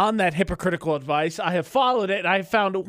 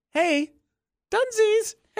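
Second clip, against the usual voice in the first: very high calling voice; machine calling out; slower than usual. Retold or imitated.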